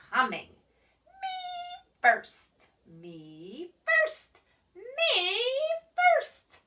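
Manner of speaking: a hint of a teasing creature voice; lightly voiced small creature. A narrator's reading. Creature mocks the pig's cry, lightly voiced. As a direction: tease him, mimicking his cry.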